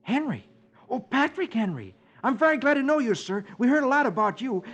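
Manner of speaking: put-on high, bright eager greeting of a boy; young hero-worshipping voice; eager young Dick. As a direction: sound thrilled to meet a hero.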